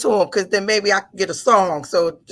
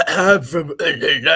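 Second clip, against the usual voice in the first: lower, slower put-on bass; mock deep London; 4 semitones lower, 3 dB louder, slower than usual. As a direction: push the voice down for bass. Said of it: "put a little bass".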